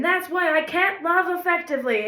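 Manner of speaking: loud, high, dramatic turn; sudden dramatic revelation. Retold or imitated. In a journal between grocery notes, dramatic and loud. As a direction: blurt out a sudden dark insight.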